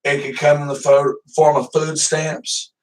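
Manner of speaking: ordinary talk, nothing marked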